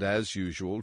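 ordinary delivery, nothing marked

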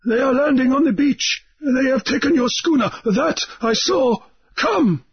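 high, alarmed report; high, alarmed urging; urgent islander. A narrator's reading. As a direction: warn him and hurry him off. Mauriri reports the landing.

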